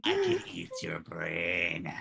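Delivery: slow, low creepy murmur; creepy dream whisper. Acted. Whispering in an unconscious man's ears, slow and creepy. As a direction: draw it out low, like a creature haunting a sleeper. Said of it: "still whispering in his dre".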